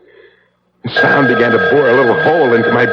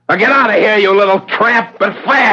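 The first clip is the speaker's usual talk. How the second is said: angry dismissal; 11 semitones higher than usual. Acted. Sam throws her out.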